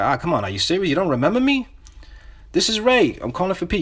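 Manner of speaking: insistent and a little urgent; urgent insider. Shown as a demonstration. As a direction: push as if they should know you. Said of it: "a little urgent".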